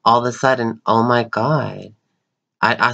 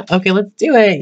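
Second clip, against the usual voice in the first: high, louder eager okay; higher voiced eager agreement; higher friend reply; 9 semitones higher, 5 dB louder than usual. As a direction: chirp it back as the friend. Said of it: "friend's reply".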